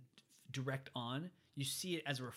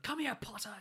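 higher-pitched imitation of an angry summons; mock yell; 10 semitones higher, 3 dB louder than usual. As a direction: yell it as the villain, without full volume. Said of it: "Quirrell yells".

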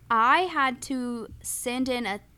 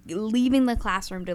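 normal talking voice

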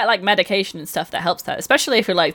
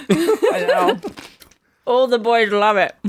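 talking while munching crisps; words around a crunchy mouthful; crunching crisps; 3 semitones higher, slower than usual. Real, not acted. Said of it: "Sorry, I've been in Chris".

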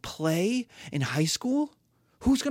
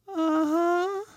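high smug uh-huh; 12 semitones higher than usual. Acted. Lord confirms.

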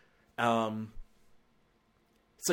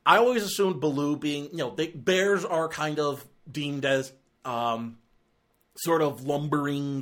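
ordinary talk

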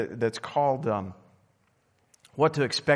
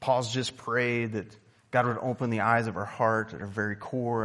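ordinary talk, nothing marked

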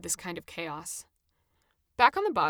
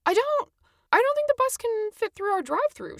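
much higher, louder, flustered and frowning; an anxious staffer pushing back; frazzled, frowning worker; 6 dB louder than usual. Acted. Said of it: "I could hear the frown in her voice".

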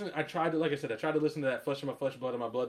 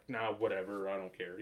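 low, soft dismissive shrug; playing it off; 7 semitones lower, 9 dB quieter than usual. Imitating people hiding their anger, playing it off. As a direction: brush it off quietly, lower and softer. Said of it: "just wanna play it off like".

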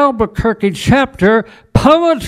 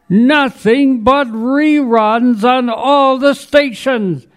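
higher-pitched dismay, performed; dramatic exasperation; 7 semitones higher than usual. Acted. Poet tells of a TV full of reruns, dramatic exasperation. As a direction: build up the viewer's frustration. Dramatic poem about angry viewer.

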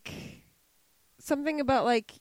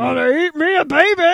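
loud, gleeful monster growl; baby-eating monster; 2 semitones higher, 9 dB louder than usual. Retold or imitated. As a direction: relish it like an ogre. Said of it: "someone who's about to eat a baby".

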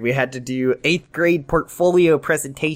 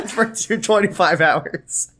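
slow, high words through laughter; helpless giggling delivery; laughing; 4 semitones higher, slower than usual. Real, not acted. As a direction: squeeze it out while cracking up. Laughs along with the joke.

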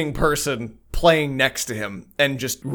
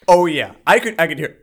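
ordinary talk

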